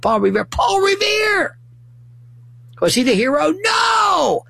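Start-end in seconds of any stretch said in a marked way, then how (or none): 0.4-1.5 high, drawn-out mock-heroic shout; mock acclaim. Mock acclaim of the poem's hero. 3.5-4.4 high, hammed-up shout of denial; emphatic denial. Answers own mock hero question.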